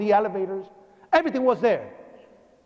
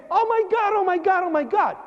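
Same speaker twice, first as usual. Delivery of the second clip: high, run-together panicked cries; imitated horrified screaming; 9 semitones higher than usual. Onlookers seeing the injured man, imitated screaming in horror. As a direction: re-enact shock at a high sustained pitch. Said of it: "everybody's screaming in horror".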